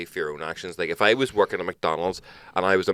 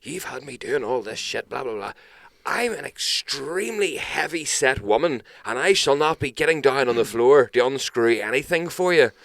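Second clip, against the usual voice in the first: higher, slow haughty put-on customer voice; imitating huffy customer; 7 semitones higher, 4 dB louder than usual. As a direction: mimic the indignant caller, drawn out. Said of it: "She's like".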